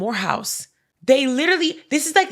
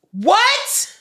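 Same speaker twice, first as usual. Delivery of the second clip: outraged disbelief; 12 semitones higher, 5 dB louder than usual. Real, not acted. Outraged at the cop's response.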